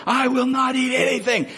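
mock rage at being offered the king's food; mock furious. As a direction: play a puffed-up Mister Furious refusing. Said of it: "go Mister Furious and be like".

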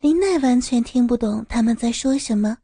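plain reading voice

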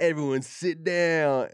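loud, high, drawn-out gangster order; movie gangster. Acted. Copying gangster movies to control his friends, hammed-up loud command. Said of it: "I've seen some gangster movies".

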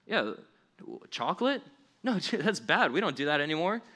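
high, scoffing, with a laugh; mock dismissive staff. In-N-Out worker waves off the chocolate order, high and laughing. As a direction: scoff at chocolate like it's absurd.

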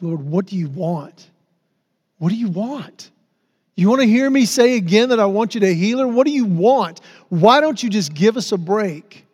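raw, pleading cry to God; desperate prayer. Retold or imitated.

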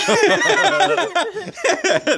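burst of high laughter. Real, not acted.

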